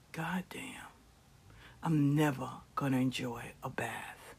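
whispering. Retold or imitated. Whispered aside in bath.

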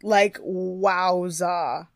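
very slow, stretched "wowza"; drawn-out wowza. Real, not acted. Awed reaction.

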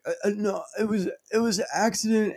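high, stammering fake panic; acting out a cornered culprit; mock panicked pleading. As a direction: stammer out a high, soft excuse. Pleading with imagined ninja Fauci.

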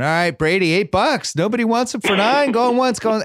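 high, loud auctioneer call. Retold or imitated. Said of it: "our auction guy was going".

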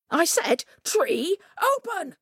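high, rising command; insistent cry. A narrator's reading. Coyote trapped and ordering the tree, high insistent cry. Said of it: "he cried".

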